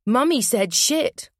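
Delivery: put-on high, slow tattling kid; tattling child. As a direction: tell tales in a singsong.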